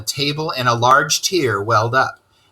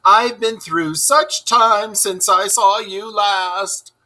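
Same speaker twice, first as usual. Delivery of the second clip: higher, slow, woeful Toad voice; Toad crying out; 9 semitones higher, slower than usual. Acted. Toad telling Ratty of his ordeal, slow and woeful. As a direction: draw out the hard times like a sorry toad. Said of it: "he cried".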